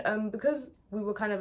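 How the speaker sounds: normal talking voice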